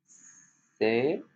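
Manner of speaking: ordinary delivery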